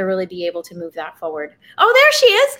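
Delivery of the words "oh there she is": excited. Real, not acted. Host's wife appears on stream.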